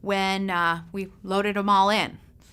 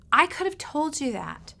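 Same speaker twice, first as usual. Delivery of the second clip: ordinary delivery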